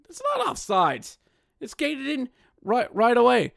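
high, exasperated rebuttal; frustrated, high correction. Real, not acted. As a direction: correct them in disbelief.

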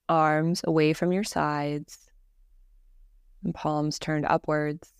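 calm, measured meditation voice with long pauses; guided meditation. Acted. Teacher leading a listener through Yoga Nidra breathing, guided meditation voice. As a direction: guide them gently into rest, evenly and unhurried.

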